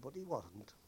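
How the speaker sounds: ordinary talk, nothing marked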